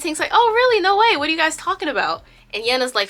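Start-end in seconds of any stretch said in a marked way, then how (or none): 0.3-2.2 high, loud eager questioning; exaggerated surprise. Tink asking Yena about the texts, exaggerated surprise. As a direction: burst in with bright mock amazement. Tink feigning interest in friend's texting.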